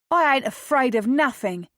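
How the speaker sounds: high, loud defiant Cockney lad; defiant Cockney boy, chin up; Cockney defiance. A narrator's reading. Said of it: "in a decidedly Cockney accent".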